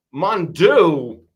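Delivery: mock French accent, slow; a hammed-up Frenchman sighing. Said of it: "I don't know if I could do a French Christopher Walken".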